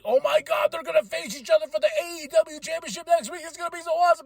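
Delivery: high, fast fanboy gush; excited gushing. Retold or imitated. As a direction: relive your giddy hype in a rush. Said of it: "I was so excited about".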